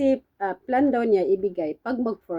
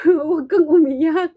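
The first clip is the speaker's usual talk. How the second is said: high, louder, crying through the words; comforting employer, tearful; 7 semitones higher, 6 dB louder than usual. Retold or imitated. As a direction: soothe her while half crying yourself. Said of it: "sabi ni amo". (amo na umiiyak habang nagpapakalma)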